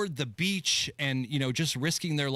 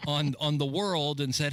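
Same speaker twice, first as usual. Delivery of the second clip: normal talking voice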